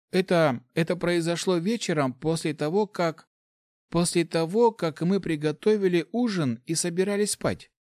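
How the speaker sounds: high, halting subordinate voice; nervous report. A narrator's reading. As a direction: stumble through bad news timidly. Cowering officer reports to commander.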